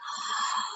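sharp unvoiced gasp of surprise; a quick breathless puppet gasp.

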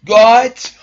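shrieking high with shock; sudden high-pitched disbelief; shocked gasp. Real, not acted. Shiny found after long hunt.

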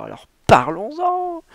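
slow, high, loud mocking exclamation; mocking hilarity. Shopkeeper mocking Balthazar's villa, high and gleeful. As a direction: throw it out with scornful glee. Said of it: "une hilarité qui lui secouait le ventre".

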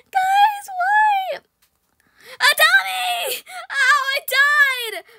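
high loud anguished wail, slow. Real, not acted. Player dying in game, high loud wail to viewers. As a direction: wail to the audience.

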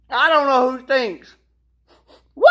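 usual speaking voice

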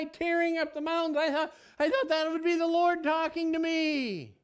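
wide-eyed voice expecting God in the wind; mock awe. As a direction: act amazed at the storm. Preacher acts out awe at the wind.

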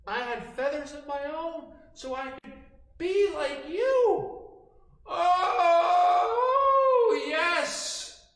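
high, loud gushing flattery; a sneaky fox buttering up a crow, high and loud; sly flattering fox. Acted. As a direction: pour on fake praise to the crow, squealing. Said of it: "starts to say".

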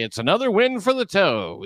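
high-pitched mocking brag; mock boast. Mimicking a man who calls everything a win, mock triumphant.

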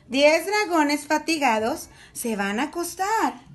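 usual speaking voice

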